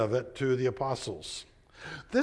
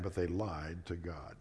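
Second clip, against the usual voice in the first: hushed solemn verdict; 8 semitones lower, 10 dB quieter than usual. Acted. Echo of Peter's verdict.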